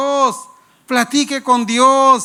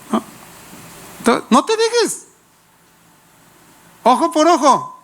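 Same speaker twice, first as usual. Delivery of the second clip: much higher put-on voice of the friend egging you on; imitating the friend's advice, pitched way up; mock worldly friend; 7 semitones higher than usual. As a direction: play the bad-influence friend, high and cheeky.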